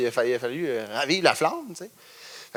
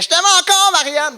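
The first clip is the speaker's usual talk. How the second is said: high, loud screamed declaration; yelled love call; 5 dB louder than usual. Retold or imitated. Guy in the crowd yelling his love at Marianne, high and loud. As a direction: belt it out like a lovesick fan. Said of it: "qui criait".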